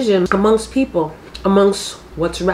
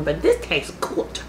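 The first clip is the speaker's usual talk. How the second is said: talking through a mouthful of watermelon; words muffled by crunchy fruit; crunching watermelon; 5 semitones lower, 7 dB quieter than usual. Real, not acted. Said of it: "excuse the crunching".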